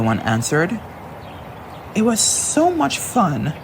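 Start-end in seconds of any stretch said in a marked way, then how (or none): 1.9-3.5 high, slow delighted line; happy memory. Acted. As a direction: savour the memory. Elita recalls fun.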